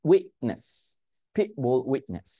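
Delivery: "witness" with a catch in place of T; no T release in "pit" or "witness"; stopped T. Shown as a demonstration. Teacher modelling the whole phrase, stopped T. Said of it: "এখানেও টি উচ্চারণ করে নেই".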